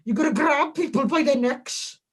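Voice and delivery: mock-sweet coaching in a Welsh lilt; [name]. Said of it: "I don't know why I put that accent on".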